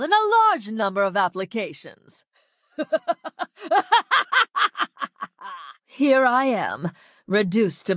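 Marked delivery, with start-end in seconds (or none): 2.8-5.5 long, high villainous laughter; maniacal laugh. Acted. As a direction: let an evil laugh build in bursts.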